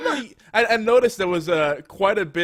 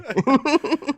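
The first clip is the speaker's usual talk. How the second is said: bursts out laughing; real laugh; 11 semitones higher, 7 dB louder than usual. Real, not acted. Laughing at his remark.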